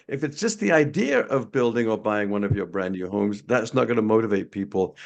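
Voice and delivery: normal talking voice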